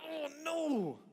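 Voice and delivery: high strained "oh no" wail; hammed-up cry of dismay; mock anguished dismay. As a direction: groan it out high in acted regret. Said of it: "And you grind".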